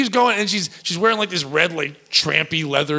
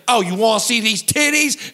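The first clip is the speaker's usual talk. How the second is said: higher, imitated Long Island accent; New Jersey accent; 5 semitones higher than usual. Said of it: "she talked in this New Jersey, Long Island accent".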